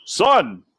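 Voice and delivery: a hint of a barking father; mildly gruff dad. A narrator's reading. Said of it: "barked Despereaux's father".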